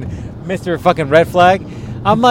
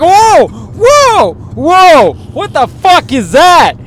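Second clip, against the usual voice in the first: high, loud, drawn-out whoas of alarm; shocked; 12 semitones higher, 7 dB louder, much slower than usual. Retold or imitated. Friend reenacting seeing the hickey, high and shocked. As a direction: act stunned at the sight of a huge hickey. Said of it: "I was like".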